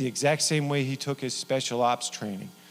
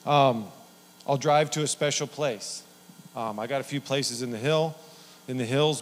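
normal talking voice

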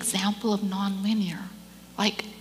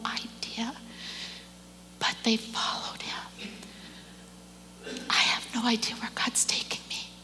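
whispered; 11 dB quieter than usual. Real, not acted. Hushed reflection over music.

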